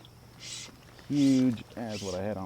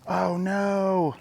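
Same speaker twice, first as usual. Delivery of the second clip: loud, high cry of dismay; real anguish, raised and loud; dismayed outburst; 10 semitones higher, 8 dB louder than usual. Real, not acted. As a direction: cry out as the catch slips away. Loses a big fish.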